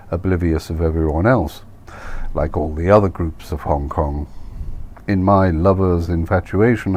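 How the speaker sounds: plain reading voice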